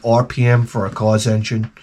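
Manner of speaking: usual speaking voice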